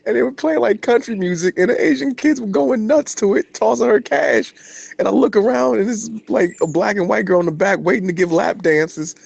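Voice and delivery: high-pitched astonished retelling; disbelieving. Real, not acted. Telling friend about strip club, high disbelief.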